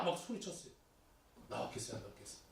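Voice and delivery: ordinary delivery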